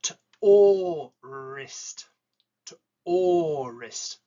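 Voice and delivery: or stretched long and slow in to or wrist; drawn out or. Shown as a demonstration. Teacher slowly drawing out or in to or wrist for students. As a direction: hold the or sound long before wrist. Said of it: "then we have or".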